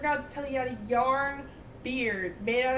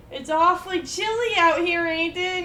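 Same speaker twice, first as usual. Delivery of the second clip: sarcastic jab; 4 semitones higher, 7 dB louder than usual. Retold or imitated.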